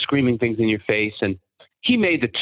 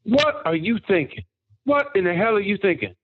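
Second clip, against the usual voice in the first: much higher mock disbelief; exasperated drill sergeant; 8 semitones higher than usual. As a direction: play the sergeant's stunned outrage. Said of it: "and he was like".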